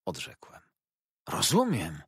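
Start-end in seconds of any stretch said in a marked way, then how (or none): 1.3-2.0 eager, pleased Rozumiem; shaking with glee; delighted exclaim. A narrator's reading. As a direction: Mr Dick struck by a happy idea, delighted. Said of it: "zawołał, ... trzęsąc się cały z zadowolenia".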